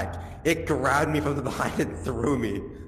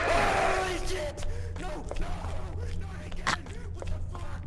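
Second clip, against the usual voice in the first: terrified shriek; 6 dB quieter than usual. Real, not acted. Grabbed by the monster.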